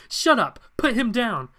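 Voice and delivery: loud, high dramatic order; acted angry outburst; shouted command. Acted confrontation with the villain.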